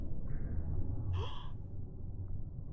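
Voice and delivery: gasp. Real, not acted. Reacting to the harpoon hit.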